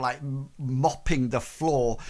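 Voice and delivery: ordinary talk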